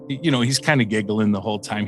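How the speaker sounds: ordinary delivery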